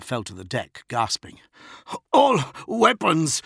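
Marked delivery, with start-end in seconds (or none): 2.1-3.4 high, slow, choked shout; coughed out. A narrator's reading. Choked officer giving fire orders, coughing. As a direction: force it out after being throttled. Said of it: "he coughed".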